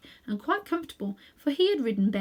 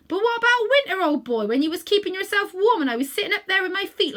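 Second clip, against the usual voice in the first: louder, coaxing Cockney cabbie; 7 semitones higher, 7 dB louder than usual. A narrator's reading. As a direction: coax the old horse like a London cabman. Cabbie recalling winter.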